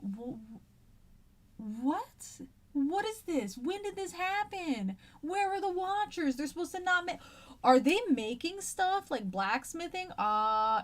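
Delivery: high-pitched, incredulous questioning, building in volume; bewildered archangel. Acted. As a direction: play an archangel shocked by the scene below. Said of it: "you're one of these archangels".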